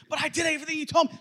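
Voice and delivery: put-on high, rushed childish excuse; protesting kid. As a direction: whine back fast at a parent. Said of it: "do that to your kids".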